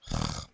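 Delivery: long heavy defeated sigh; dramatic sigh. Real, not acted. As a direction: sigh out all your frustration. Gives up after failed clicks.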